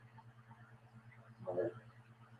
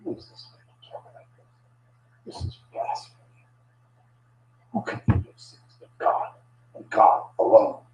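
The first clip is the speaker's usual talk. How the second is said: hushed, unvoiced murmur of suspicious scribes; near-whispered private grumbling; muttering among themselves; 3 semitones lower, 5 dB quieter than usual. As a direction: question it under your breath, confiding in your own circle. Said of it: "they were questioning among themselves".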